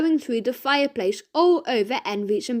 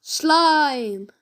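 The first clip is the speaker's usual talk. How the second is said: mild mock shout; 3 semitones higher than usual. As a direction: call it out, not full force.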